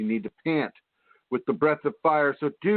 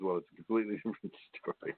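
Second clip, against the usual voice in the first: quick and softer, breaking into laughter; laughing; 4 semitones lower, 10 dB quieter, much faster than usual. Real, not acted. Host laughing at his leprechaun joke, quick and soft. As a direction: giggle through the words.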